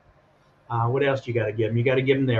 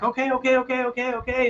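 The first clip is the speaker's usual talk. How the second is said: quick flustered okays, much higher; nervous give-in, much higher voice; 10 semitones higher, faster than usual. Retold or imitated. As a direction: hurriedly placate the guard in a raised pitch. Flustered giving in to guard.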